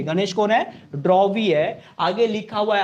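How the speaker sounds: ordinary talk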